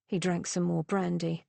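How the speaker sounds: ordinary delivery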